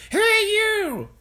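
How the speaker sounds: put-on high, loud, shrill-old grandma yell. A narrator's reading. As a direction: bark it down from the rooftop. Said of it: "she yelled".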